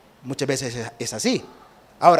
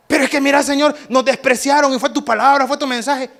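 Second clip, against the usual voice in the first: discípulos agitados; 5 semitones higher than usual. Pretend.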